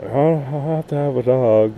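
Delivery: lightly hammed-up whine of a dog lover; mock whiny dog owner. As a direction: parody someone who just must have a dog.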